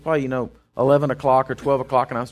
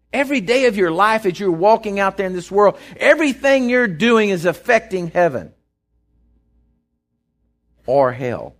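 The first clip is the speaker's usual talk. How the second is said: higher and louder, driving each phrase home; full-force preaching; 6 semitones higher, 4 dB louder than usual. Acted. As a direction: preach it with rising fire. Preacher exhorts the congregation.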